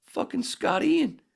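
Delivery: high, drawn-out excited hiss of a name; starstruck. Retold or imitated. Nudging [name] at the Roxy, high and starstruck. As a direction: point him out thrilled.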